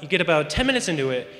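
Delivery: ordinary talk, nothing marked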